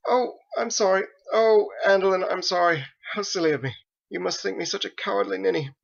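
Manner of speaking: high, breathless embarrassed sorry; apologize, flustered and silly; flustered apology. Acted. Faith apologizes to Andolin after her scare, flustered and high.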